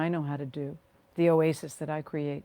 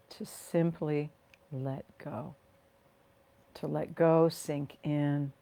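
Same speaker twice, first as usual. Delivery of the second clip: guided meditation. Real, not acted. Leading listeners to rest and ground through their feet, guided meditation. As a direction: ease them into the body, phrase by unhurried phrase.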